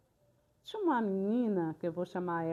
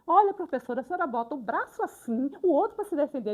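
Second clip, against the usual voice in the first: high, loud imitation of an insistent girl; raised, urgent acted voice; indignant pupil; 6 semitones higher, 7 dB louder than usual. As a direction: instruct the teacher like a worked-up pupil. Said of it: "ela continuou indignada".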